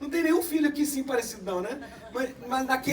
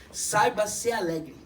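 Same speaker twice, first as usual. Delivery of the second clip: usual speaking voice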